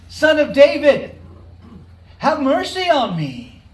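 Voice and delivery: loud, high shouted plea. A narrator's reading. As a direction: shout it out, desperate to be heard over the crowd. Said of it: "But he only shouted louder".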